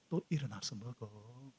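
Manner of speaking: soft, slow coaxing; gentle angel. Acted. Angel softly waking Elijah, tender and slow. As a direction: gently coax him to eat.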